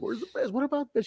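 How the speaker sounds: high-pitched mock fretting, softly pestering; imitating an announcer's questions. Acts out Scott's anxious questions.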